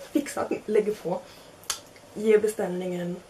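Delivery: ordinary delivery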